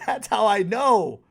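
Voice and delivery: loud, high and slow; emphatic declaration. Real, not acted. Wrapping up the Tony Stark hype, slow loud declaration. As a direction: lean on each word like a verdict.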